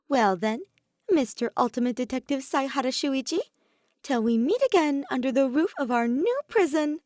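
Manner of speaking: loud, high playful villain send-off; hint of a grand trickster exit; theatrical farewell. A narrator's reading. Oma's dramatic farewell to Saihara.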